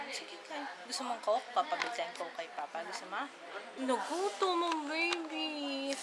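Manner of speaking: usual speaking voice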